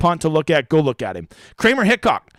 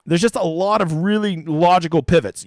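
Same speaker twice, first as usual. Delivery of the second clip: ordinary talk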